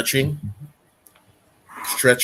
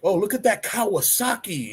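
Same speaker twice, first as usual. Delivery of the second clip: high, mock-impressed voice with anglicized Kawasaki; mispronouncing admirer; 9 semitones higher than usual. Instructor mimicking people who say Kawasaki, high mock admiration. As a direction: gush over "Kawasaki" in a pitched-up voice. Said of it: "Like how people say".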